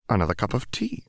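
put-on old inventor, rising hopefully on tea; lightly voiced old scientist asking sweetly; a hint of a chirpy elderly mentor; Heimerdinger's voice. A narrator's reading.

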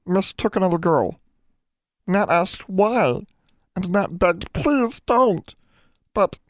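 high, pleading elf voice; halting little creature close to sobbing; high-pitched, miserable elf confession; tearful house elf. A narrator's reading. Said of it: "broke into another bout of violent sobbing".

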